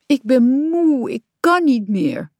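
high, drawn-out tired moan; exhausted whine with stretched vowels; weary groaning. A narrator's reading. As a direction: groan it out slowly, worn out. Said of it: "steunde hij".

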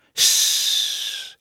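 breathy, voiceless cat hiss. A narrator's reading. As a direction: hiss like a wary old cat. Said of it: "zei tante Moertje". (zacht, stemloos kattengesis)

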